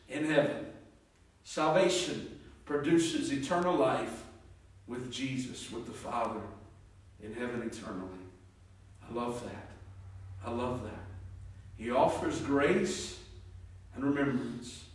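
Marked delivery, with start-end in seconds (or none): none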